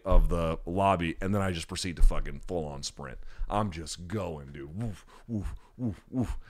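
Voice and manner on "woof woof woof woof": quick soft huffs of a runner; mimed running breaths; imitated sprinting puffs. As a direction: puff out whooshes as if running. Imitates himself sprinting away.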